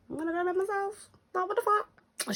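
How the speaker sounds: high, fast and fed up; shrill inner rant to herself; exasperated inner voice. Retold or imitated. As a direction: squeak it out in disbelief. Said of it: "in my head, I'm thinking".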